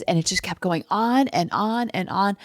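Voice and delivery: ordinary talk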